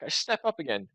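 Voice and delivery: usual speaking voice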